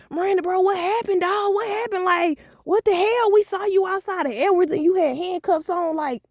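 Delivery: put-on high excited gossiping kids; nosy classmates. Classmates grilling her at school, high and eager. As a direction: pile on the questions, way up high. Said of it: "everybody asking me questions".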